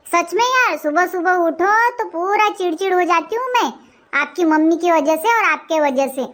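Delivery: ordinary delivery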